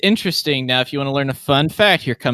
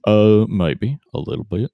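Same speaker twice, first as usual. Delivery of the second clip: put-on slow, low drawl in a regional accent; lazy low drawl, accent laid on; Sam Elliott drawl; 3 semitones lower than usual. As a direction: answer in a deep, unhurried accent. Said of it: "Is it Sam Elliott?".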